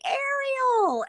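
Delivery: high, loud squeal of the name Ariel; excited fan squeal. Retold or imitated. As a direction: scream the name like a starstruck fan. Fans shouting her name excitedly.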